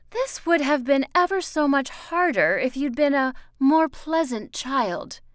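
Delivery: high, loud, emphatic woman's voice; Miss Foster, cutting. Acted.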